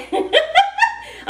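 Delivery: a high, sudden real laugh; high burst of laughter. Real, not acted. Laughing at her own joke.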